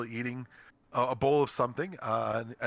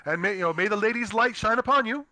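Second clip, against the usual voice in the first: higher, louder, a hint of a kindly innkeeper; innkeeper's blessing; 8 semitones higher, 4 dB louder than usual. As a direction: bless the travellers as they leave. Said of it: "the, uh, innkeeper".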